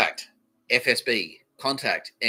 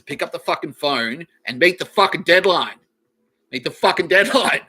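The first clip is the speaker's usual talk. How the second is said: higher, much louder angry demand; furious yelling; 7 semitones higher, 9 dB louder than usual. Real, not acted. Warning viewers to meet the deadline, angry shout. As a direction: shout the order at them. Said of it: "Yes, that's the tone".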